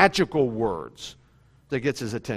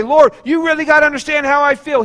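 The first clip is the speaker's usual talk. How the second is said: higher and louder pleading; mock pleading prayer; 9 semitones higher, 7 dB louder than usual. Preacher imitating a believer begging God, raised voice. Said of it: "we don't have to really say".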